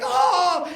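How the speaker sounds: high, amazed "oh"; mock squeal of joy at a present; delighted kid gasp. Son opening his costume, high thrilled gasp. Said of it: "He was so excited".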